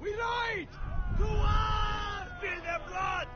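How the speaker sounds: high rallying war shout; battle cry. Acted. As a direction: rouse the troops to war. War cry in game dialogue.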